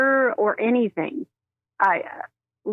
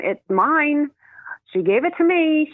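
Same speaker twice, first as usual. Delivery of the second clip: higher, sassy imitation of the sister; 7 semitones higher than usual. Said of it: "She's very sassy about it".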